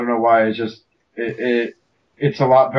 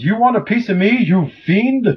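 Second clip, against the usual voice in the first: high, loud mock hero defiance; mocking Jazz's cheesy battle line; hammed-up heroic challenge; 8 semitones higher, 3 dB louder than usual. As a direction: ham up a cartoon hero's taunt. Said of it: "jumps on the Megatron and goes".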